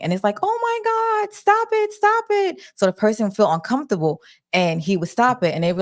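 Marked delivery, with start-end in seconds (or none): 0.4-2.6 very high squealed imitation of screaming; mock screaming. Retelling what is heard after the red button, high mock scream. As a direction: plead in a shrieky falsetto without real volume. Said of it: "all you hear is screaming".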